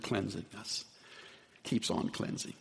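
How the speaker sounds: "Keeps on cleansing" whispered, soft and unvoiced; hushed repeat of "Keeps on cleansing"; whispered echo. Shown as a demonstration. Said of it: "Even uses the same word".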